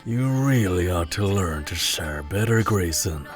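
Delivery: deep, grinning pirate taunt; insufferably smug. A narrator's reading. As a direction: goad a rival in a low voice with a grin. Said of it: "the pirate said with an insufferable grin".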